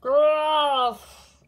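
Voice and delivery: high, very loud monster roar; big drawn-out growling shout. A narrator's reading. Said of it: "loudly said".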